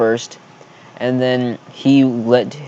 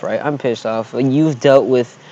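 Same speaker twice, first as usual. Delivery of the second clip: usual speaking voice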